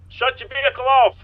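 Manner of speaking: loud commanding officer voice; shouted police commands. Acted. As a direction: bark orders at the driver. Commands suspect at traffic stop.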